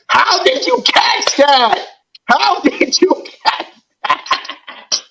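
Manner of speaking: high, drawn-out incredulous question; amazed disbelief. Real, not acted. Fan marvelling at Goddard's catch, incredulous.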